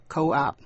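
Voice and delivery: co-op as "koh-op"; two-syllable co-op. Shown as a demonstration. Teacher reads the hyphenated word, co-op in two syllables. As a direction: sound both vowels in co-op, "oh" then "op". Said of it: "Còn C O hyphen O P".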